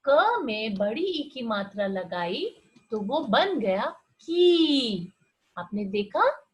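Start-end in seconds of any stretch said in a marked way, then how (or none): 3.6-5.1 "की" stretched long and slow; long ee sound. Shown as a demonstration. Teacher showing children the long ee in "की". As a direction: draw out "की" so the ee lasts. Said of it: "वो लंबी होती है और ऊंची होती है".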